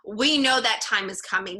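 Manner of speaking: ordinary delivery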